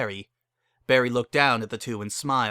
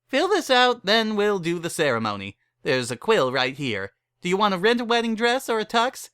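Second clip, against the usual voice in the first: brassy saleswoman; 8 semitones higher than usual. Acted. Barry pitches her wedding packages to the couple, brassy and higher. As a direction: rattle off the package menu like a hustler.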